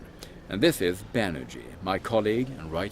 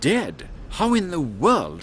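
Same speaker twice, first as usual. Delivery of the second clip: shocked; 9 semitones higher, 5 dB louder, slower than usual. Acted. Hearing the organist is dead.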